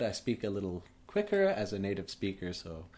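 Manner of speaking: normal talking voice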